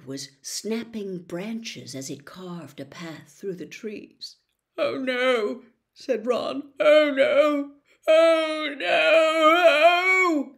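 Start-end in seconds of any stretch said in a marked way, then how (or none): 4.8-10.5 rising, louder panicked moans; panicking. A narrator's reading. Ron hearing something huge coming, rising panic. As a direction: let the fear climb with each oh no.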